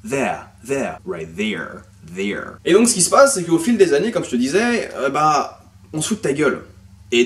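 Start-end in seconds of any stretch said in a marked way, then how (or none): 0.1-1.0 British "there" with the R dropped; British accent. Shown as a demonstration. Teacher showing how Great Britain says it, British "there". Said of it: "en Grande-Bretagne, on va dire". 1.1-2.6 lower-pitched American "there" with a very strong R. Shown as a demonstration. Teacher showing his own American accent, super strong R on "there". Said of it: "le même R super fort".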